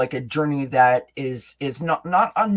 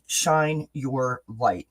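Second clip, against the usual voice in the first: slow emphatic repeat; 2 semitones lower, much slower than usual. Shown as a demonstration. Repeated slowly.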